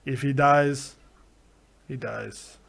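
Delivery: low, cold Russian menace, fading away; deadpan boxer's threat; Ivan Drago impression. Host quoting Drago to his co-host, low Russian menace. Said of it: "Ivan Drago".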